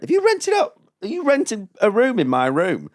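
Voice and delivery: high, loud incredulous protest; indignant outburst. Retold or imitated. Comic confronting the venue over his room, indignant. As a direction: blurt it in high-pitched outrage.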